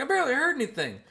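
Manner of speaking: high, loud playful jab; loud teasing. Retold or imitated. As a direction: tease the crowd. Teasing crowd.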